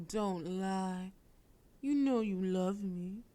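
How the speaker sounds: ordinary talk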